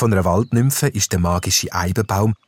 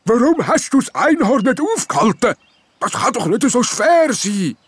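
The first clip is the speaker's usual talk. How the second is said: high, cross gnome voice; grumpy gnome. A narrator's reading. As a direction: scold the jester for missing the unicorn. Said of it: "mit einer tiefen Stimme hässig".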